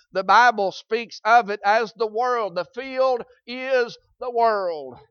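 solemn sermon proclamation, slowed right down; slow preaching. Acted. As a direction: hammer each word home to the church.

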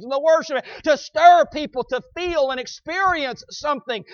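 fervent preaching. Real, not acted. Preacher warning the church against chasing experiences, fervent preaching. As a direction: build to hammered key words.

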